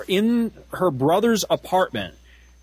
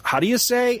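mock foreign speaker; 9 semitones higher than usual. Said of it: "the foreign dude ... says".

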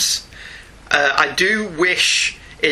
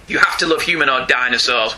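ordinary talk, nothing marked

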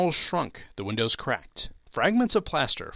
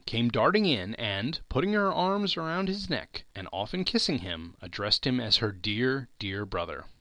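ordinary delivery

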